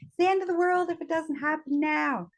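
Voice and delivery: very high, mock-panicked outburst; frantic urgency. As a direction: act out a control freak losing it. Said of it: "That's how I felt".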